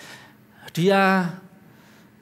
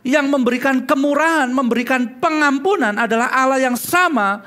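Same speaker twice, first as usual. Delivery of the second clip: louder, ringing pulpit proclamation; louder, fervent sermon climax; full-force preaching; 7 semitones higher, 5 dB louder than usual. Acted. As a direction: preach it out to the whole church.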